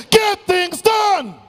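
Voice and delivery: slow, high rallying shout; shout with conviction. Shown as a demonstration. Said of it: "Say this with conviction".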